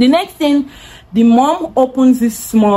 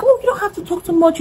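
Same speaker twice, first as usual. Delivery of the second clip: imitated worried sibling; 9 semitones higher, faster than usual.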